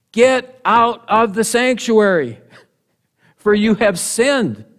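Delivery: loud, high command to leave the sanctuary; priestly rebuke. Acted. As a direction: order the king out with full authority. Priests ordering king out.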